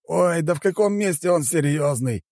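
high-pitched, dismissive chairman; mild scoffing. A narrator's reading. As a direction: scoff lightly at the assistant's claim. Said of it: "усмехнулся председатель правления". (с лёгкой усмешкой, высоким голосом)

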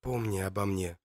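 soft hushed whisper. Acted. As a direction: breathe it out barely voiced. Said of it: "шепчет он".

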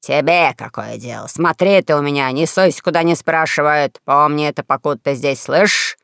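low, gruff angry snarl; angry king. Acted. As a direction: snap at the boy, low and threatening. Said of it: "Король как накинется на меня".

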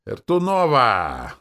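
loud, drawn-out high announcement; ecstatic squeal. A narrator's reading. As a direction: finish the name in a long shout. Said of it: "Ленора восторженно завизжала". (восторженный протяжный выкрик)

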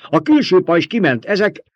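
high, loud battle report; alarmed shout. A narrator's reading. Officer reports the outer shield down, alarmed shout. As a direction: shout over the noise, urgently. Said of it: "kiáltott az egyik sardaukartiszt".

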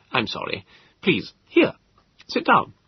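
high, hurried polite host; flustered host. Acted. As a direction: remember your manners, a bit flustered. Host offers a seat.

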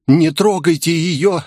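high, pleading wail; wailing cry. A narrator's reading. Bald boss shielding the young employee, high wailing plea. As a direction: cry out to protect the girl. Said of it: "завопил лысый дядька".

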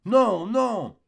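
high, drawn-out "no, no"; protesting wife. Acted. Wife refuses her husband's advances.

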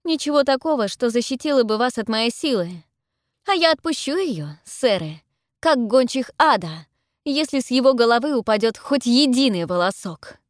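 high, forceful, assured threat; ringing, defiant warning; loud and confident. A narrator's reading. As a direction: threaten them loudly and surely. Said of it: "говорю я громко и уверенно".

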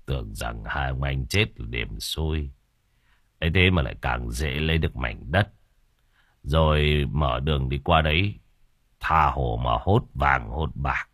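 scheming husband. Acted. Bằng praises his wife for grabbing the land, low sly voice. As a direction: savour the windfall quietly. Bằng's voice.

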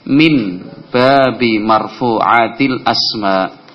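drawn-out recital; teacher reading the Arabic phrase slowly to the class, carefully spaced; slow modelled Arabic. Shown as a demonstration. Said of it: "kalau saya menyampaikan intahaina".

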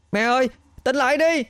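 very high, fast frightened plea; desperate child's cry. A narrator's reading. Boy begging his mother to wake, desperate cry. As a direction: cry out high without real volume. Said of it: "Nó gào lớn".

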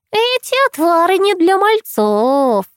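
high slow crone voice; old crone voice. A narrator's reading. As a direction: tease like a sly old crone.

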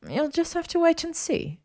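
high-pitched, playful withholding; lilting, mischievous high voice; coy tease. Acted. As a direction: sing it out to keep him guessing. She teases him, walking away.

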